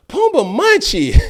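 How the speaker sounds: put-on high, loud, slow kid taunt; high kid teasing call. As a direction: sing out the nickname like a little kid. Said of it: "He said".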